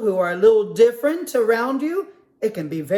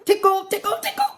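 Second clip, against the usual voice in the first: much higher, squeaky tickle-tickle; rising teasing tickle sounds; playful tickling; 11 semitones higher, faster than usual. Acted. Percy tickles his friends.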